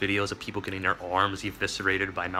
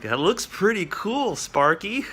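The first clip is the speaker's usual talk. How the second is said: higher, louder, slow mock praise; faking interest; 9 semitones higher, 5 dB louder, slower than usual. Speaker to Sparky, hammed-up fake enthusiasm. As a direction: bluff that it looks cool. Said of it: "it was like".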